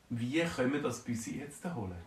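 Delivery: ordinary delivery, nothing marked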